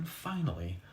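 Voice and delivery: usual speaking voice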